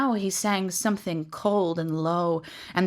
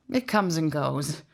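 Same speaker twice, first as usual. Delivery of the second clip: hint of forced casualness; forced lightness; 3 semitones lower than usual. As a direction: lightly brush it off, hiding pain. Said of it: "tried to make his voice light".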